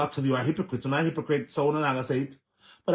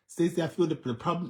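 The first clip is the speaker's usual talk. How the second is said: normal talking voice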